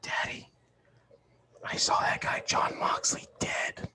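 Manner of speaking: son's hushed secret whisper. Retold or imitated. Little son whispering close against his dad's leg. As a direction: breathe it like a secret in dad's ear. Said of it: "He goes".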